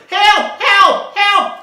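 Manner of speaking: very high, loud panicked yells; frantic high shouts; crying for help. Acted. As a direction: scream for help like the fish is in danger. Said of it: "cried the fish".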